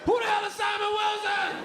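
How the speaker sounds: hammed-up baffled yell; exasperated shout. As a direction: shout it in outraged disbelief. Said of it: "Everybody like".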